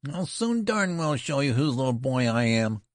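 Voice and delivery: put-on high-pitched ferocious boyish retort. A narrator's reading. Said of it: "retorted Benjamin in a ferocious voice".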